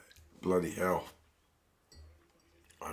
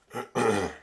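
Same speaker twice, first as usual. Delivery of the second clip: loud throat clearing; 5 semitones higher, 5 dB louder than usual. Real, not acted. Hoarse commentator clears throat.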